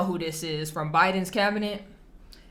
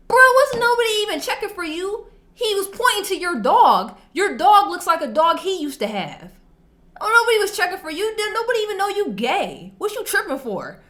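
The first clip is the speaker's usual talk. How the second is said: loud, high retold telling-off; retold confrontation; 9 semitones higher, 4 dB louder than usual. Retelling how she told off the kid in the lobby, loud and high. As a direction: raise your voice and call him out.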